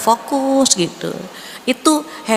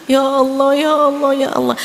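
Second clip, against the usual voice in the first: high, pleading mock sob of ya Allah; imitating a crying prayer, pitched up; mock tearful prayer; 6 semitones higher than usual. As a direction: act out a weepy plea, a touch overdone. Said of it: "Nangis kita".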